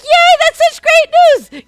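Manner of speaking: hammed-up glee at good news; mock cheer. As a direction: gush it over the top.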